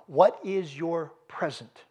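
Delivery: drawn out, each word weighed; slow it down and let it sink in; slow, deliberate question. Acted. Preacher repeats his key question.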